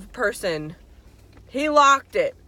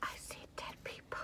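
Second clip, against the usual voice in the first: eerie whispered movie line; creepy movie kid; 23 dB quieter than usual. Retold or imitated. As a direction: breathe it like the spooky kid. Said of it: "what is that movie called? Where the kid's like".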